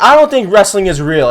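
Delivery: whiny interviewer. Retold or imitated. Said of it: "he's like".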